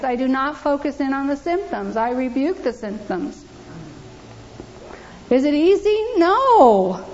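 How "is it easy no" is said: higher and louder, hammering the answer; fervent pulpit cry; emphatic preaching. Real, not acted. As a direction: throw the question out, slam down No. Preacher answers own question emphatically.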